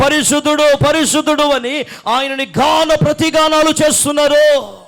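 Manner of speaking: loud preaching building to a high roar; full-force preaching. Real, not acted. (బిగ్గరగా, ఉద్వేగంగా ప్రసంగం)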